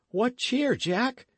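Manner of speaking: a lad cheering his friend; young Dick. A narrator's reading.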